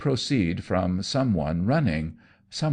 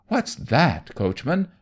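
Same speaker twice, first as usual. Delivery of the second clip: higher, panicky question; frightened shriek; 7 semitones higher than usual. Acted. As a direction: shriek it at the driver in fear. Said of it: "shrieked Anno".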